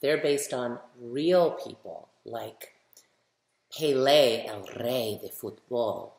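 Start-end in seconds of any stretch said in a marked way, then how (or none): 3.7-6.1 Spanish pronunciation. Shown as a demonstration. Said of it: "Pelé, the king of soccer".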